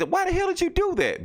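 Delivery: mock disbelieving yell, squeaking high; shocked outburst. Jonah Jameson shocked that Spider-Man took the bullet, high mock yell.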